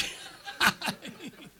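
high-pitched cackling laugh; high giggling fit; demonstrated laughter. Shown as a demonstration. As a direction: burst into a high, breathless laugh. Demonstrates laughter people stare at.

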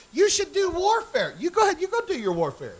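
high-pitched mocking voice; mock advice-giver. Somebody out there urging the pastor to do warfare, high mocking voice. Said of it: "somebody out there saying".

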